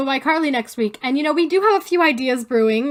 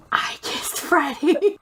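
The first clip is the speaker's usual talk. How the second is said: mock confession; 3 semitones higher, much slower than usual. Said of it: "you were gonna go".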